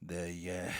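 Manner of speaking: ordinary talk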